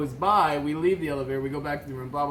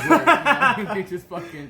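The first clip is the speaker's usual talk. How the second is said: laughing; 4 semitones higher, 7 dB louder than usual. Real, not acted. Laughing tease.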